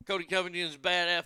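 ordinary delivery

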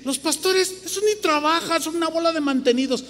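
high mocking voice of the gossiping critics; a high, hammed-up voice mocking the critics. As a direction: mimic the scornful crowd up high. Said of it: "Hay un montón de gente que dice".

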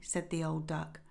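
plain reading voice

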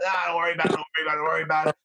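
rapid-fire mock dismissal, words running together; retold brush-off. Drunk man to his worried friend, very fast dismissive brush-off. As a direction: rattle it off to wave away a warning.

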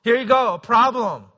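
lightly voiced Life, teasing as it hands him trouble; life handing over a problem. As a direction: pastor voicing Life dropping a problem on him, mock cheery. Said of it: "Life would be like".

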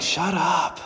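high, hissing hush of a scolding crowd. Acted. Crowd silencing the blind beggar, high, scolding hush. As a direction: shut him up, half whispered and sharp. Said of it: "everybody around him's like".

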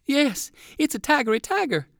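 put-on high, breathless boy voice; a kid sure he's seen a tiger; boy exclaiming. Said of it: "exclaimed the other boy".

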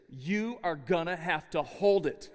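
husband telling his wife in labour to hold it, slow and firm; blunt order. Retold or imitated. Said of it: "I looked over her and I said".

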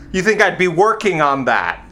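ordinary talk

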